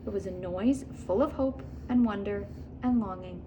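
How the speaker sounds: ordinary delivery, nothing marked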